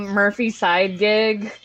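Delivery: ordinary talk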